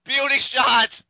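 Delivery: high, loud finisher call; excited call. Real, not acted. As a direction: shout the move as it hits.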